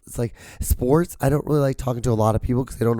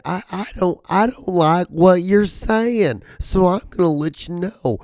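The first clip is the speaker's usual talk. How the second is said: negative commenter voice; 7 semitones higher than usual. Retold or imitated. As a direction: drag it out high like a sniffy troll. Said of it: "that's the voice".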